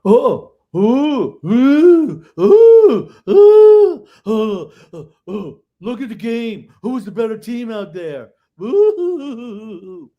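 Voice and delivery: high, loud mock wailing that builds to a peak and fades; mocking crybaby wail. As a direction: whine like a sore loser crying over the game. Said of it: "Nathan MacKinnon coming out and going".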